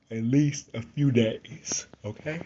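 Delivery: words stretched through a yawn. Real, not acted. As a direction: talk mid-yawn, slow and drawn out. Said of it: "It wouldn't be a video if I didn't yawn".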